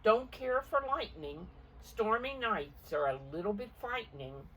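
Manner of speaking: a goose scared of the storm; nervous goose. Acted. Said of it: "said Goose".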